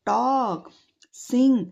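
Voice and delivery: ordinary talk, nothing marked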